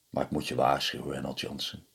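low, soft foreboding voice; ominous warning. A narrator's reading. Old man warns Reynold, low and hushed. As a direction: warn him darkly and quietly.

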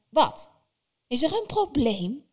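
loud, high snap of the witch; sharp witch challenge. Acted. Baba Yaga snaps at hesitating Vasilisa, loud and high. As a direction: cut her off with a threat.

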